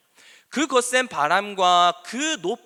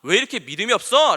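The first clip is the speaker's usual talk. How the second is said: loud, raised-pitch imitated telling-off; 예수님; 3 semitones higher, 7 dB louder than usual. Preacher acting Jesus rebuking scared disciples, loud scolding. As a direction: scold them bluntly, voice raised, as a quote. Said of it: "라고 하면서 꾸짖으셨다니까".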